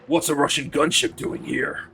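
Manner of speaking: rasping American soldier voice; gruff video-game hero radioing in; Snake. Acted. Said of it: "two seconds away from Snake".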